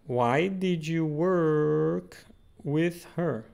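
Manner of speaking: each word spelled out slowly for the class; drawn out so students can repeat; slow careful model. Shown as a demonstration. Teacher modelling the English question to students, slowly.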